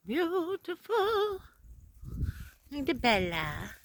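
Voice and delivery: admiring. Real, not acted. Admiring dog, high, slow.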